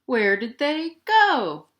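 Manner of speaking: ordinary delivery, nothing marked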